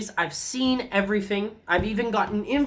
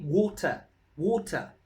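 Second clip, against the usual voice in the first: South African "water", no r at the end; South African "water" with a crisp t; South African accent; 2 semitones lower, 3 dB quieter than usual. Shown as a demonstration. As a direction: say "water" the Queen's English way, ending in "tuh". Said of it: "That's how we say it".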